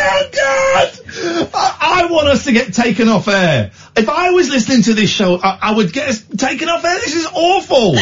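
high, shrieking through giggles; real mortified laughter, way up in pitch; squealing Oh God with laughter; laughing in horror. Real, not acted. Mortified giggling after embarrassing joke.

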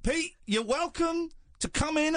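high, slow inviting call. Acted. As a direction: call out to Pete grandly. Host addresses absent dad directly.

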